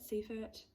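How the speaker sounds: Seifert said as SEE-fert, softly; unsure name. Shown as a demonstration. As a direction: Seifert with a long ee, quiet third try. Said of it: "I apologize".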